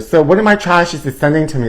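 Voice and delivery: usual speaking voice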